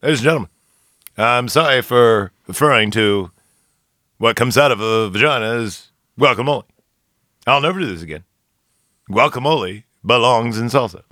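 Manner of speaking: lightly voiced Obama, measured pauses; a hint of a presidential address; Obama impression. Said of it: "Let me do as at Obama".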